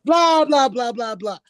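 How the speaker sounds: chatter bursting in. Retold or imitated. Chatter as the doors swing open, loudly imitated. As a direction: let the mimicked chatter burst out. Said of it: "the doors swing open and hear".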